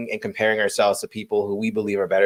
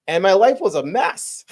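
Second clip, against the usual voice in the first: usual speaking voice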